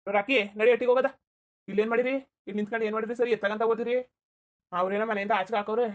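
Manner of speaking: loud urging call. Acted. Calling loudly in the street.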